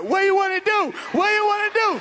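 high, loud challenge; challenging shout. Retold or imitated. Squaring up before the fight, loud and high. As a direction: yell it up in his face.

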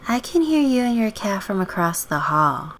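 put-on high, girlish neighbor voice; friendly neighbor girl. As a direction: pitch it up as the chatty girl next door.

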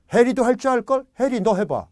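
loud, high excited urging. Retold or imitated. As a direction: egg a friend on, loud and high. Retold excited friend urging Harry.

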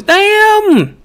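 very high, stretched comic "damn"; Chris Tucker voice. Pretend. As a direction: squeal the word "damn" up high. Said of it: "In my Chris Tucker voice".

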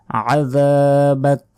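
আযাবাক ending on bare k; slow chanted আযাবাক, final vowel dropped; stopping with sukun. Shown as a demonstration. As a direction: stretch the middle of আযাবাক, cut it at k. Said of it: "শেষ অক্ষরে সাকিন দিয়ে".

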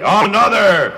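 announcer call. Retold or imitated. The off-mic voice calling for another egg, loud. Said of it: "this voice would say".

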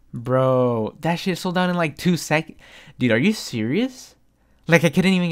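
shocked, exasperated, nearly yelling; outraged disbelief. Real, not acted. Retelling the instant sellout.

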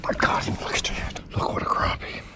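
hushed and delighted; thrilled whisper at the fish; excited whisper. Real, not acted. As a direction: whisper it so the dogs don't bark. Whispering over the catch.

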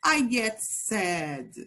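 slow, clear model for repeating; teacher modelling for children to repeat; slow model phrase. Shown as a demonstration. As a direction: say it slowly for the class to copy.

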